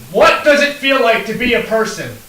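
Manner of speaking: shouting a question to the mob; shouted question. Acted. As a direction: call it out over the crowd. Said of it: "I'm gonna shout out".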